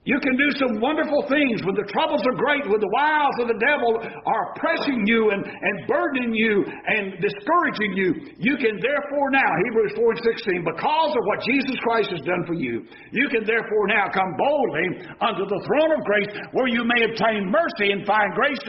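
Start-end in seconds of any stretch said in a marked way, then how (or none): none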